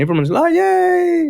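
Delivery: high squealed yay; a sudden high cheer; mock cheer. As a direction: imitate the office cheering. Colleagues cheering in Slack.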